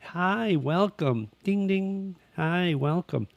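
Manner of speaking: high, sing-song shop chime voice; seven eleven greeting. Retold or imitated. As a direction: mimic the door chime and the automated greeting. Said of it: "that little ding dong sound every time you enter seven eleven".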